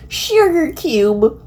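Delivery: high, slow drawn-out sugar cube; mock-sweet high drawl with no face behind it; Applejack impression. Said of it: "Imagine Applejack walks up to you".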